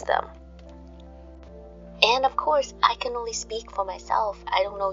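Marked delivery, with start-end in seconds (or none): none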